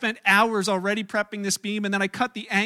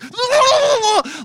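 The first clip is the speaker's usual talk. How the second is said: high, loud drawn-out holler; wild mock scream; 13 semitones higher, 9 dB louder than usual. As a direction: holler like a road-rager out the window. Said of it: "I do this".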